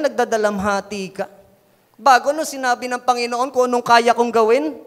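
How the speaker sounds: usual speaking voice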